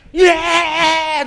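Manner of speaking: high, very loud drawn-out yell; imitating excited dog. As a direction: let out a frantic pet's joyful scream. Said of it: "it's so excited. It's got the zoomies".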